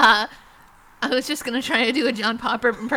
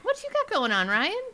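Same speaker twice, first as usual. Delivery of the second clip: lyric quote; 7 semitones higher than usual. Pretend. Quoting Digital Underground lyric.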